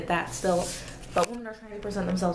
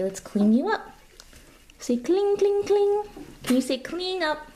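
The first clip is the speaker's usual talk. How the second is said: put-on higher, sing-song voice for a toddler; baby talk; 3 semitones higher than usual. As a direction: coo and coax like a parent to a baby. Talking to her baby son.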